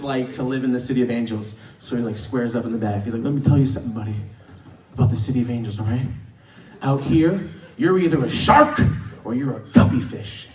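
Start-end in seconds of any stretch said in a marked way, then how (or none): 3.2-10.5 low, confiding pep talk; intense motivational passenger. Retold or imitated. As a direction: square up and lay down street wisdom. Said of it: "And Tony Robbins".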